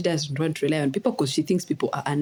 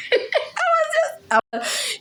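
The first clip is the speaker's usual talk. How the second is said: laughing; slower than usual. Real, not acted. Cracking up telling her own story.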